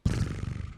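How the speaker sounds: rolled, voiceless trilled purr; imitated feline trill; cat's purr. A narrator's reading. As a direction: purr like a contented cat. Imitates the cat purring.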